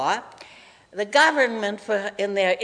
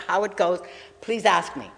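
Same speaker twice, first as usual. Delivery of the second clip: ordinary talk, nothing marked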